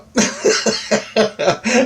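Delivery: high burst of laughter; laughing. Real, not acted. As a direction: let a laugh break out. Laughing at the story.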